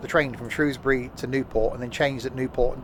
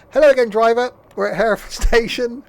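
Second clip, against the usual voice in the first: higher, louder announcer read; train announcer voice; 8 semitones higher, 6 dB louder than usual. Acted. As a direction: announce it to the driver. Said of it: "It's like he was invoiced".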